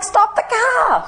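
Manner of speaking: very high, slow, stretched-out mock yell; retold scream, drawn out. Insisting again to colleagues, slow high stretched yell. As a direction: drag each word out in a high, crying yell. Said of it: "you know, screamed".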